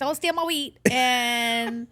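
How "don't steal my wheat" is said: a hint of a high, whiny Gideon; mock Gideon. Cohost mocking Gideon hiding his wheat, lightly whiny. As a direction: lightly whine it like a scared farmer. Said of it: "Don't want anybody stealing wheat".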